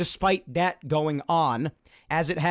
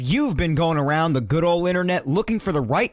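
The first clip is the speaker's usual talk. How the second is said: loud podcast open; 3 semitones higher, 5 dB louder than usual. Acted. Host opening his podcast, loud and hyped. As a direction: sell the show like a radio host. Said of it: "my loud open".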